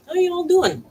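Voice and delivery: high, loud singsong greeting to the pets. Real, not acted. Greeting her pets, high loud singsong hello.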